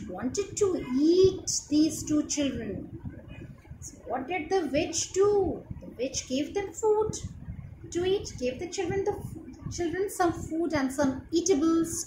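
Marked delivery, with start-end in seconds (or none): none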